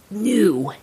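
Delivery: ordinary delivery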